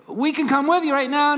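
pitched high and loud, pleading to tag along; eager plea. Retold or imitated. Disciples plead with Jesus to come, an eager high plea. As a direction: beg to come along, keen.

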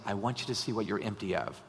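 hushed, tender divine voice; God speaking gently. Retold or imitated. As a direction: speak it quietly like a gentle invitation. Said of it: "And God's saying".

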